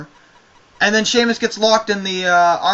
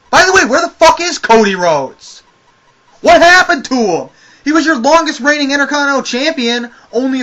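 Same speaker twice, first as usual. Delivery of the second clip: exasperated outburst; 6 semitones higher, 5 dB louder than usual. Real, not acted. Exasperated rant about missing wrestler.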